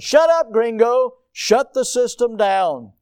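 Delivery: loud, high irritated pilot; imitating an exasperated pilot's shouted order; snapped. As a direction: yell back at the alarm to silence it. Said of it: "and he said".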